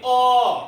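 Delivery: "ओ" as one long, held vowel; vowel pronunciation demo. Shown as a demonstration. Teacher showing students a vowel sounds by itself, drawn-out demo. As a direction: hold "ओ" as a single pure sound. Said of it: "जैसे आप बोल के देखिए".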